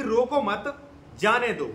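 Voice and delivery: "रोको मत", a clear pause, then "जाने दो"; group "रोको मत" together, break, then say "जाने दो"; pause after मत. Shown as a demonstration. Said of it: "उसके दो मतलब निकल आएंगे".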